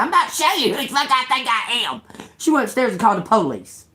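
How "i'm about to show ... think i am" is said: high, fast mocking threat; mimicked threatening neighbour. Neighbour threatening her in a quarrel, high mocking imitation. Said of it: "She said".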